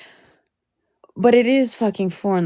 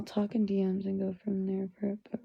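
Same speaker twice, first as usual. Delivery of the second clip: a whispered note to a friend; whispered aside; 12 dB quieter, faster than usual. Real, not acted. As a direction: whisper it, far softer. Aside to someone off-stream.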